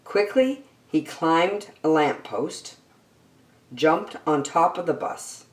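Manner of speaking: usual speaking voice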